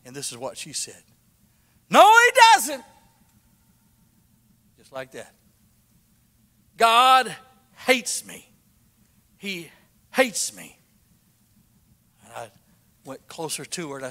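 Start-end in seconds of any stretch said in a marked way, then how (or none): 1.9-2.8 high, loud anguished retold shout; loud cry. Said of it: "real loud". 6.8-10.8 high, loud despairing retold wail; loud cry. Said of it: "real loud".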